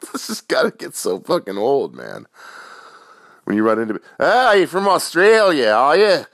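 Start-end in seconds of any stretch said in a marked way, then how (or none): 4.2-6.2 loud, high, hammed-up mock Australian accent; mock someone parroting an exaggerated Aussie accent; big stretched-out fake Australian twang. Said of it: "you have the stereotypical".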